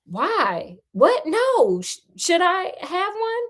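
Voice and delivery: rising, startled questions; surprised. Acted. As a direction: caught off guard, voice climbing. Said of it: "He had given up being surprised".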